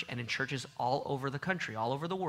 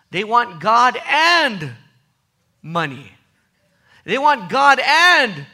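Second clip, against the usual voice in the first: loud, high, slow hammering refrain; full-force preaching; 7 semitones higher, 14 dB louder than usual. Acted. Preacher warns the church about divided hearts, in loud, slow preaching. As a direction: pound each "and" like a pulpit drum.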